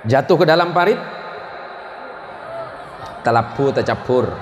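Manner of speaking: ordinary talk, nothing marked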